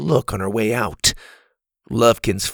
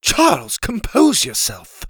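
a hint of a refined, proper lady; calm him with a classy voice; proper accent; 9 semitones higher than usual. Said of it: "in her proper accent".

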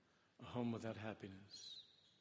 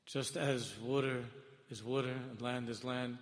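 ordinary talk

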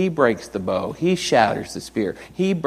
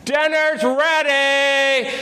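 high, slow drawn-out call; calling out dinner; much slower than usual. Retold or imitated. Dad calling the kids to dinner, long high call. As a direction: holler it across the house. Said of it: "tell the kids dinner's ready".

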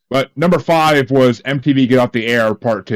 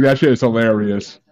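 normal talking voice

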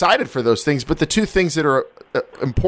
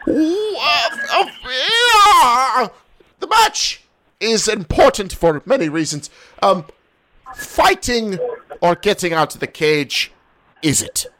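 put-on British correspondent, higher, with a laugh; hammed-up posh Brit, a bit higher; mock British accent; 7 semitones higher than usual. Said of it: "your Australian accent".

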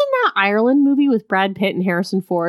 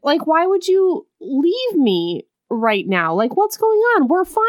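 high, whiny disbelief, pitched well above own voice; mocking the confused boyfriend; 9 semitones higher than usual. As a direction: play the baffled fiancé in a higher mock voice. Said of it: "And so then he's like".

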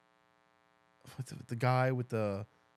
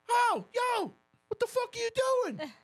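high-pitched hollering dad; mock angry yell; 3 dB louder than usual. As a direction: yell high and outraged, chasing kids off. Acting out dad yelling at kids.

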